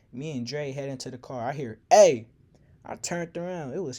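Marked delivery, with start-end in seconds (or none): 1.9-2.3 loud shout across the parking lot; calling out. Retold or imitated. Boyfriend calling after the narrator at the car, shouted. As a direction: yell to stop him.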